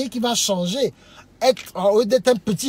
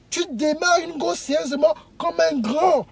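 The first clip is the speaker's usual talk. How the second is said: ordinary talk, nothing marked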